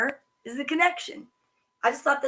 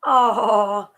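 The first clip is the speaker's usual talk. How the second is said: cooing aww; 6 dB louder than usual. Real, not acted. Cute picture of bear bed.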